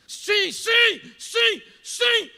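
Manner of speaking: high, explosive shouts of yes; frantic shouting. Acted. Excited boy yelling advice to the TV contestant, frantic shouting. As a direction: yell each word like a frantic kid at the screen.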